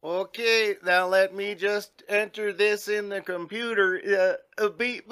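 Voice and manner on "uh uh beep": usual speaking voice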